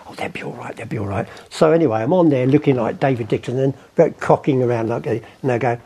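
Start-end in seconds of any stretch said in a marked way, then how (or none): 0.1-1.3 much softer, lower mock nurse; soft reassurance. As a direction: lightly voice the nurse hushing. Nurse's reassurance voiced in story.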